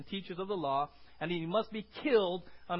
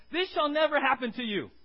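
rebuking; 7 semitones higher, 8 dB louder than usual. Acted. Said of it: "he said".

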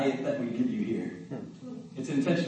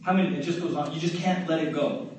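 ordinary delivery

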